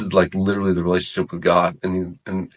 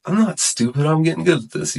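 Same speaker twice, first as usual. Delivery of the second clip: higher-pitched indignant self-defence; retold upset, pitched up in protest; 9 semitones higher than usual. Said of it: "I got upset about that".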